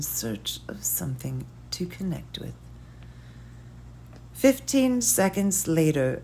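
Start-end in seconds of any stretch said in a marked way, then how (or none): 0.0-2.5 fading soft whisper; a hushed trailing narration. A narrator's reading. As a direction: let the thought drift off in a whisper.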